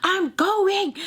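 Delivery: high-pitched whiny call back; imitating Sam. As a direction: call it high, as if through a door. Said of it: "you could just hear Sam".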